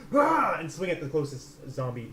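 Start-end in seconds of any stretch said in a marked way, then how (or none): 0.1-0.6 high, loud "rah" war cry; short raised attack roar; battle cry. Acted. Player swinging a blade at a zombie, battle roar. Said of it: "and just go".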